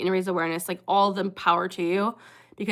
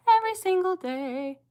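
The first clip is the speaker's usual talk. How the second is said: whiny fake sob, pitched much higher; mock crying; 10 semitones higher than usual. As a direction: wail it like a hammed-up cry. Mock wail about daily hate.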